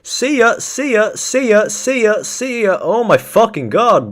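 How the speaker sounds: high, loud repeated yelps; exclaiming loudly. Real, not acted. Streamer sending someone off with see ya, high yelling. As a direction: yell it high over and over.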